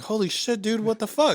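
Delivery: high, alarmed burst of panic; reliving the moment the charges hit; panicked. Retold or imitated. Telling a friend about seeing the charges, panicked. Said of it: "I'm fucking freaking the fuck out".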